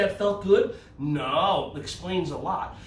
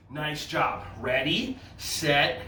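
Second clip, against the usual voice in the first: usual speaking voice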